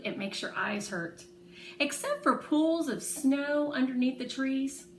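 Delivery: usual speaking voice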